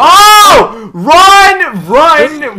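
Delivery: high loud panicked yell; panicked scream. Real, not acted. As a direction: scream at them to run. Fleeing in panic.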